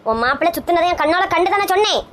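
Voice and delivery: ordinary talk, nothing marked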